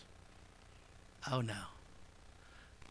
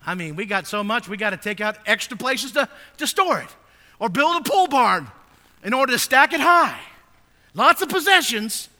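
loud, ringing pulpit voice, pitched higher; full-force preaching; 8 semitones higher, 14 dB louder than usual. Acted. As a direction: preach it out to the whole congregation. Preacher building on Americans' possessions.

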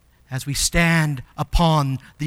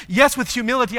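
normal talking voice

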